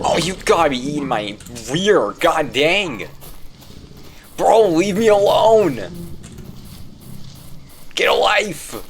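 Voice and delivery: high, loud whining shout; high-pitched exasperated outburst; exasperated yelling. Real, not acted. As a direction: yell at them to back off, fed up. Yelling at enemies chasing the player.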